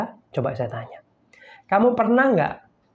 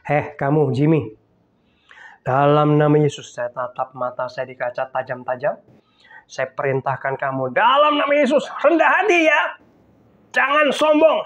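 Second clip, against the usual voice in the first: commanding himself. Retold or imitated. Pastor commanding himself in the mirror, stern. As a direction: order yourself in the mirror, rising. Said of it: "Ngomongnya gini".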